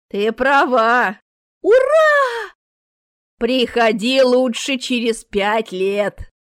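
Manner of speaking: put-on loud, drawn-out old-lady triumph; gleeful old woman. A narrator's reading. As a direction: let the cheer soar, then dismiss her slowly. Old woman cheers, dismisses visitor.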